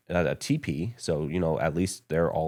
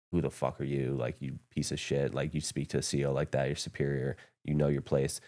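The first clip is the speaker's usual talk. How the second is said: retold chewing-out; 3 semitones lower, 7 dB quieter than usual. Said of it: "just started going at me".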